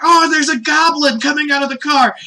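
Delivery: high, loud cry of alarm; Goku, alarmed. Acted. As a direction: shout a hammed-up warning. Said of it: "Goku's like".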